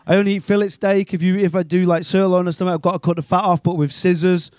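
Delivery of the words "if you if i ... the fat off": ordinary delivery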